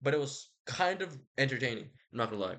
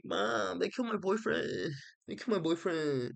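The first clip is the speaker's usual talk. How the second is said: whiny crybaby; 5 semitones higher than usual. Acted. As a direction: wail it like a kid tattling to mom. Achilles whining to his mother.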